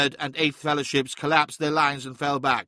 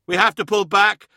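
higher, louder battlefield shout; 7 semitones higher, 8 dB louder than usual. A narrator's reading. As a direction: shout over the din of battle. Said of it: "shouted Hathor Mart".

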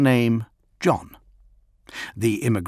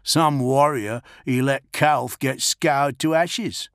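sulking youth; 4 semitones higher, slower than usual. Acted.

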